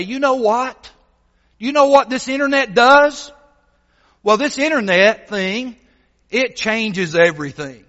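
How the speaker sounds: amazed. Acted. Old repairman discovering the internet for the first time, amazed. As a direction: build up the discovery excitedly.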